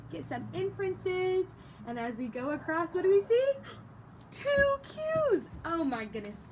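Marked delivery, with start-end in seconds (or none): none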